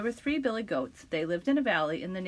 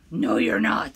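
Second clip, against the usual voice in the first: much lower, louder troll growl; 13 semitones lower, 6 dB louder than usual. Acted. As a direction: bark it low like a troll. Troll answers goat.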